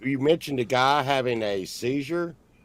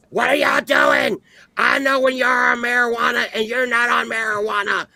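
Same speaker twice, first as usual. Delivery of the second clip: shrieked scolding, far higher and louder; screaming aunt; 10 semitones higher, 5 dB louder than usual. Retold or imitated. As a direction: imitate an aunt yelling at the boys. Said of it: "she's screaming at him".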